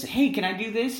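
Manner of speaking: put-on high, eager kid's request; child asking. The good child asking a parent, high and eager. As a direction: ask brightly like a good kid.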